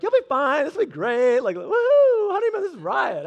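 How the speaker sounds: loud, high whooping encouragement; hammed-up gung-ho husband, leaping into a whoop; carefree cheering. Husband cheering on his panicking honeymoon wife, retold with carefree whooping.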